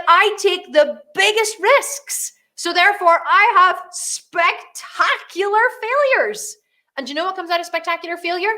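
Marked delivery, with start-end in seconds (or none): 0.1-6.6 high, very slow proclamation; retold exultation. Boss realising why the business grows, mock-grand delight. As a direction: declare it grandly, stretching every word.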